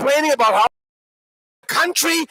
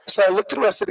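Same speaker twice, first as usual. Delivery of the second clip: ordinary talk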